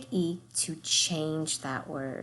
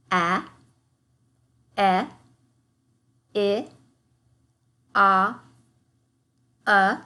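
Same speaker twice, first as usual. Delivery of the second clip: vowel sounds; 6 dB louder than usual. Shown as a demonstration. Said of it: "Echo me".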